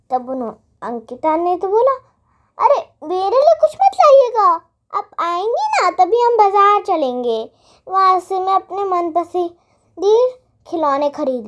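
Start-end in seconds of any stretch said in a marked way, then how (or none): 2.6-11.5 put-on high, loud childish insisting; a spoiled child telling her aunt off; bossy little girl. Girl refuses gift, wants market.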